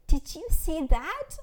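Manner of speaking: high, loud, drawn-out amazed question; excited exclamation. A narrator's reading. New mother exclaims to her own mother about the baby. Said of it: "I exclaimed".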